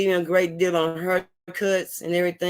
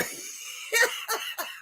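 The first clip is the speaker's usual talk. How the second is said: airy silent wheeze of laughter; laughing too hard to voice; high breathy wheeze laugh; breathless laugh; 8 dB quieter than usual. Real, not acted. Laughing at friend's joke.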